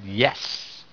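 soft, gentle yes; tender reassurance. Retold or imitated. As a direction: quietly assure her. Reassuring crying woman in prison.

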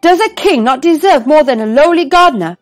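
high, loud indignant splutter; spluttering outrage. A narrator's reading. King protests the gardener's fish, spluttering with outrage. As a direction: sputter with wounded pride. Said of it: "the king spluttered".

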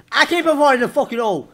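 loud, high frustrated yell; frustrated shout. Real, not acted. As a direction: shout it, fed up with the game. Frustrated by falling in hole.

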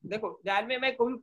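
ordinary talk, nothing marked

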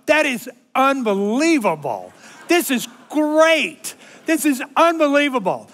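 shouted, high and thrilled, slowing on great; amazed. Retold or imitated. Grandpa with [name] beside him, excited shout. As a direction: blurt out pure delight. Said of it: "And I'm going".

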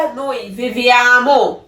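"Noi viviamo" stretched out slowly; slow model answer. Shown as a demonstration. Teacher slowly gives students the correct verb form. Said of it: "ਇਹਦੀ ਸਹੀ ਕੀ ਆ?".

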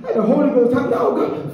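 ordinary talk

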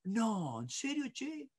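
very soft, rising concern; acted sympathetic father. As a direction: commiserate quietly at the sad child's level. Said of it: "está a mi nivel".

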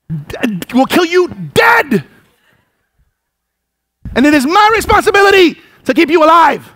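mock warning yell. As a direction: bark the child out of danger. Parent yelling child out of street.

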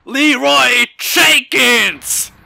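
long, stretched-out yell; battle cry. Pretend. Gamer bellowing the meme battle cry, slow and loud. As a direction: charge in bellowing it.

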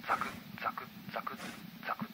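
breathy unvoiced crunch sounds, soft and even; whispered footstep crunches. A narrator's reading. Narrator miming footsteps in snow, whispered crunches. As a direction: hush each crunch like boots in snow.

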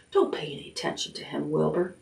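soft, quick reassurance aside; quiet aside. Acted. Charlotte quietly reassuring Wilbur, soft aside. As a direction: brush the rat off gently.

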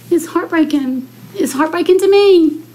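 a commissioner near tears over taxes, emotional; getting emotional. Real, not acted. Choked-up plea to fellow commissioners, emotional. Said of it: "I am getting emotional".

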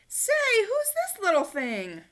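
high, loud, slow aunt voice; curious exclamation; aunt spotting a kitten; surprised aunt. A narrator's reading. Aunt Bella notices kitten.

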